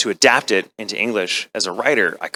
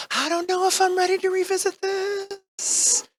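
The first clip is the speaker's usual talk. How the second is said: much higher, slow crying voice that rises to a shout; frightened at revisiting the role; mock scared wail; slower than usual. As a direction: sob it out in hammed-up dread. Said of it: "it scared me".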